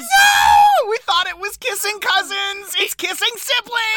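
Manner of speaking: very high, wailing shriek of disbelief; hammed-up shocked wail. Co-host learns the kissing cousins are siblings, mock-horrified shriek.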